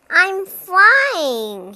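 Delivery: excited cry. A narrator's reading. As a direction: call it out, thrilled to be in the air. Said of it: "he cried".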